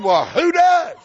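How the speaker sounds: high, loud "who does?"; mock-shocked husband, loud; loud disbelief. Stunned husband asks clerk.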